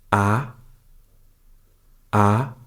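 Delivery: Ah gliding upward in pitch; rising second tone. Shown as a demonstration. Teacher showing learners the second tone, Ah rising. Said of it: "requires you to raise your voice a little bit".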